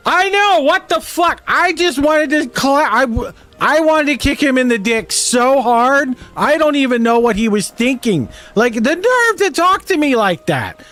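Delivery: high-pitched mock drunken ranting, drawn out; loud drunk friends. Two drunk friends venting on a restaurant patio, loud high-pitched imitation. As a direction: imitate tipsy women wailing indignantly, way up in pitch. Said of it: "she gets so loud".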